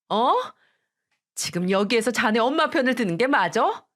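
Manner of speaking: mother berating her son-in-law, outraged; furious scolding. Acted.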